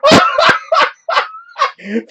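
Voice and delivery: a long high burst of laughter; hard high laughter. Real, not acted. Viewers hear him laugh at customers who crashed, hard laughing. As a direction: crack up completely. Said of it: "That's why I'm laughing so hard".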